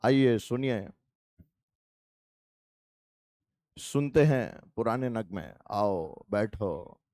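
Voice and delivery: deep slow crooning radio host; late-night RJ voice. Shown as a demonstration. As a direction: purr it like a midnight radio jockey inviting listeners in. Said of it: "तो RJ वाली आवाज हो जाती है मेरी".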